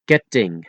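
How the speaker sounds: Ketting with no puff on K or T; unblown K and T. Shown as a demonstration. Teacher showing Dutch letters, Ketting with unblown K and T. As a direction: say Ketting in Dutch, consonants cut short. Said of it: "without blowing those three letters".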